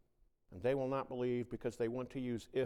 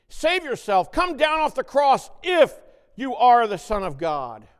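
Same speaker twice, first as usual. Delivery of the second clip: loud, high jeering shout; mocking taunt; 12 semitones higher, 15 dB louder than usual. Preacher voicing the mockers at the cross, jeering shout. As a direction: jeer at him from the crowd.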